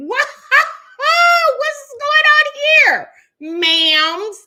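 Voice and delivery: high, loud startled "what is going on" cry; mock shock. Hamming up shock at the late live stream. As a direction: act stunned at walking into drama. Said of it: "I was like".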